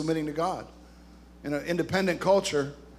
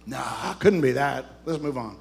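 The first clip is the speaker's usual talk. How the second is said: mock dismissal; 7 semitones lower than usual. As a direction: pretend denial, dropping off at the end. Mock brushing off his own question.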